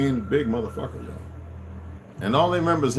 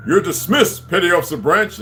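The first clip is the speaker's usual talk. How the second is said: barked naval dismissal, pitched up and louder; retold parade-ground order; officer's yell; 8 semitones higher, 6 dB louder than usual. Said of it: "he yelled something".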